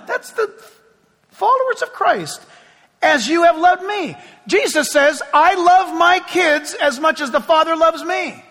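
high, loud preaching; fervent preaching. Real, not acted. Pastor preaching on Christ's love, fervent high preaching. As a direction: preach it with fire.

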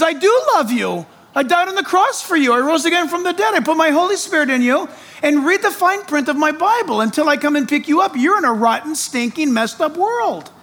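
high, fast, fervent assurance; voice of God. Pretend. Preacher voicing God reassuring the believer, high and urgent. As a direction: speak as God reassuring his child. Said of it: "God says".

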